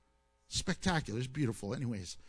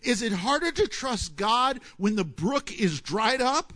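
normal talking voice

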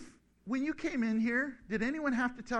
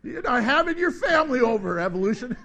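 louder, laughing jab; laughing tease; 3 semitones higher, 7 dB louder than usual. Real, not acted. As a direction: tease them while still laughing.

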